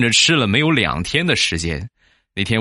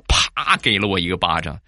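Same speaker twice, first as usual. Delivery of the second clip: ordinary delivery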